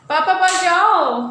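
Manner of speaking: slow loud prompt. Shown as a demonstration. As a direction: call it out slowly so the boy repeats it. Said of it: "prompting toddler to tell papa".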